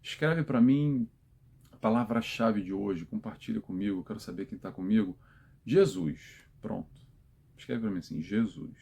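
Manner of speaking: usual speaking voice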